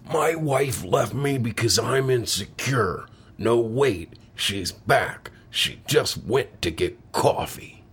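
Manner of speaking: slow comic character voice; deadpan joke in a funny voice; put-on joke voice. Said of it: "I'm gonna do a voice and a joke".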